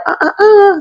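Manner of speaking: high "uh, uh, uh" scolding; imitating captors' sharp no-no-no; mock warning noises. Captors stopping the fleeing son, high mock scold. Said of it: "they're like".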